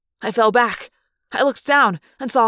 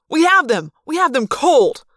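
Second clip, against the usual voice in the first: loud, higher protesting yell; 5 semitones higher, 5 dB louder than usual. A narrator's reading. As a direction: yell it in outraged disbelief at the boss. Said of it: "some guy behind her yelled".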